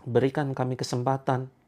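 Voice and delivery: slow, earnest prayer voice with long pauses; leading a prayer. Acted. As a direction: plead to God slowly, drawing out each phrase. Leads a group prayer. (doa yang khusyuk dan pelan)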